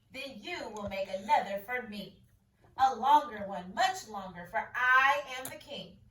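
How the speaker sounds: a hint of a royal command; king commanding. King ordering the boy, mildly commanding.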